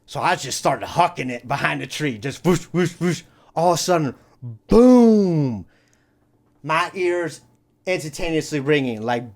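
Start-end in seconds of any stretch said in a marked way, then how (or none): 2.5-3.2 quick, repeated mouth-made whooshes; imitated whooshing. Storyteller miming his dash behind the tree, imitated whooshing. 4.7-5.7 big, loud, high boom that falls away; imitated explosion. Storyteller re-enacting the firework going off, booming explosion noise.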